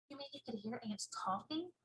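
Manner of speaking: put-on soft girlish reply; quiet small girl; little girl voice.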